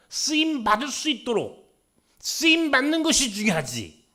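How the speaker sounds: loud, high preaching; preaching at full force. Acted. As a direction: build the sermon's climax. Pastor preaching to congregation.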